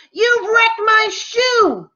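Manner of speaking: high-pitched shout at the goldfish. Acted. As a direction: yell it, pitch way up. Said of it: "they heard me shout".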